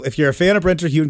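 usual speaking voice

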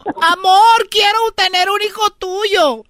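high, slow sweet woman voice; woman's voice. Acted. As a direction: coo like a girlfriend. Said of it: "le dijo".

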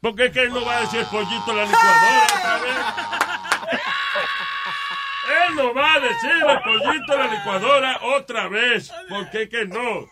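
exasperated protest. Real, not acted. Old man protesting a repeated blender joke, exasperated. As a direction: stretch the objection out in disbelief. Protesta por chiste repetido.

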